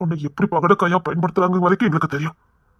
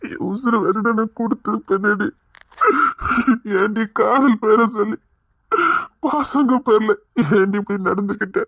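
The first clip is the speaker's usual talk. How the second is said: tearful pleading; 4 semitones higher than usual. Acted. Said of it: "எதுக்கு அழுறீங்க?".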